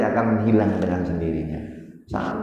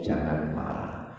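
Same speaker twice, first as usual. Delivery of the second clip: usual speaking voice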